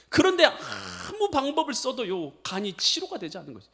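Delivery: ordinary talk, nothing marked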